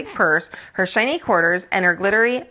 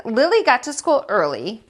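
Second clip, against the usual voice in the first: usual speaking voice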